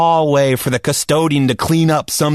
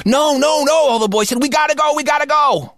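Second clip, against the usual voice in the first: much higher, urgent chorus of pleas; begging boys; 14 semitones higher than usual. Retold or imitated. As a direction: whine it like desperate kids. All the boys beg teacher.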